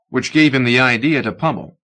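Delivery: usual speaking voice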